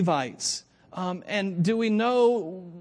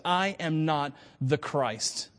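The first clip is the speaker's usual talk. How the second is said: slow, lower "I am not the Christ"; emphatic denial; 4 semitones lower, 3 dB quieter, much slower than usual. Acted. John answering the priests, slow emphatic "I am not the Christ". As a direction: give each word of "I am not the Christ" its own slow weight. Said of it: "the scriptures tell us emphatically".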